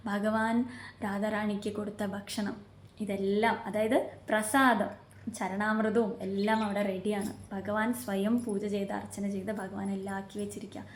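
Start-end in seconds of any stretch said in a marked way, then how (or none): none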